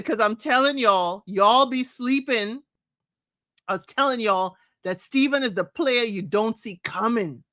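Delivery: ordinary talk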